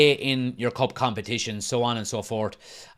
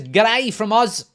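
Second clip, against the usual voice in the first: high, drawn-out Australian greeting; Australian accent; 8 semitones higher, 5 dB louder, slower than usual. Acted. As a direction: put on a loud, slow Aussie accent. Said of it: "if that was a good accent".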